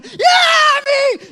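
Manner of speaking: put-on high, loud kids' cheer; excited group whoop; cheering shout. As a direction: yell it like a vanful of teens. Whole van cheering.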